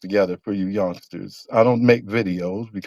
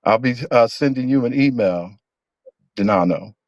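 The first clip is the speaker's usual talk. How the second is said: ordinary talk, nothing marked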